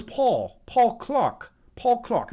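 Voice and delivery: badly faked British accent; terrible English accent. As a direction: put on a hammy English accent for the name. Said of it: "terrible English accent, British accent".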